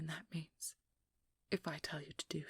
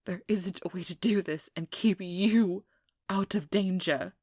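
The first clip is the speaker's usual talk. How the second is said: much louder, exasperated burst; frustrated shout; 15 dB louder than usual. Acted. Hunter insisting the vampire stay safe, shouting in frustration. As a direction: let the frustration explode.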